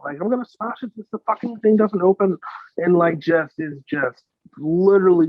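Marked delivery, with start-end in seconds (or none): 0.2-2.4 imitating a fast, higher panicked rant; mock freak-out. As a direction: rattle it off like a guy losing it. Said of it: "is melting down and freaking out".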